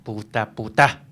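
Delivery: low, muttered swearing; muttered curse. Acted. Lieutenant cursing the writer who refused the drink, low. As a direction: spit the curse under his breath.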